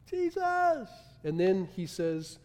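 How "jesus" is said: mock crying out. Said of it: "He's just crying".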